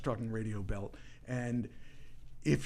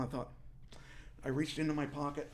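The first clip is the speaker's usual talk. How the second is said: normal talking voice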